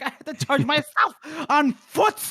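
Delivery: high, indignant rant; mock outrage. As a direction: rant like an offended general. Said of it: "He's like".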